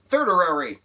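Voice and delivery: loud, high "Thirdary!" declared proudly; defiant exclaim. Shown as a demonstration. Vlogger insisting on her made-up word, loud and triumphant. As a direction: shout "Thirdary!" as if it's real now. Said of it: "That's not a word".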